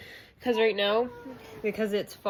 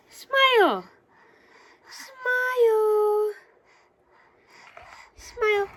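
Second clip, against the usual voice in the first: high, louder sing-song coax; 14 semitones higher, 6 dB louder, much slower than usual. Real, not acted. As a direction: lift each word up high to get a smile. Coaxing baby for camera.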